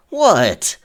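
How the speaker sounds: loud, high, startled shout of what; sudden shout. Acted. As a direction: yell back at the interruption. Shouting back at someone outside.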